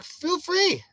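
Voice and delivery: very high, loud acted invitation; high mock invitation. Man waving troublemakers off his blocks, high mock invitation. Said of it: "I'm like".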